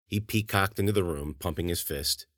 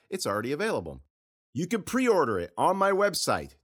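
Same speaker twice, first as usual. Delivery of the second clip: higher, upbeat host plug; podcast promo read; 11 semitones higher than usual. Real, not acted. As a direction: sell the book to listeners.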